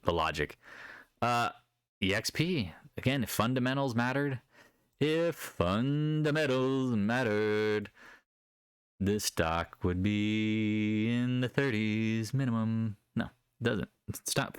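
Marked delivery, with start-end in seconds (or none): 5.0-13.0 slow and drawn out. Real, not acted. Mock-solemn aside on fundamentals.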